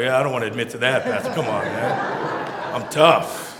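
a mild mock tough guy admitting nothing; a lightly voiced man owning up with a laugh; sheepish men. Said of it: "They're like".

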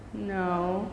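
soft, low little no; ashamed. Acted. Brooke answering Grandma, soft ashamed no. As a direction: admit it with head bowed. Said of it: "she bowed her head".

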